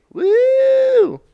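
high whoop of excitement; excited whoop. Real, not acted. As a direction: let out a big cheerful whoop. Excited podcast intro cheer.

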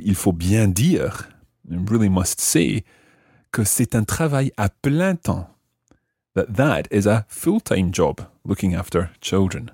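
usual speaking voice

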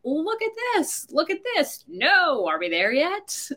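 high, bright mock parent; excited parent. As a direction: point out the sights eagerly. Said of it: "And the parent's like".

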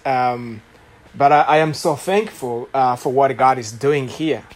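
ordinary delivery

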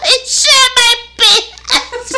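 high, slow squeal dissolving into laughter; helpless laughter. Real, not acted. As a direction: squeeze the words out while really laughing. Confesses while helpless with laughter.

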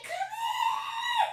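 high loud call. Retold or imitated. Calling mother into the room, loud shout. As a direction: shout for mom to come.

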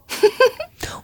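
put-on a short, high girlish giggle. Dorothee giggles at the raven councillor's scolding. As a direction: giggle brightly like a little witch. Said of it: "lachte nur".

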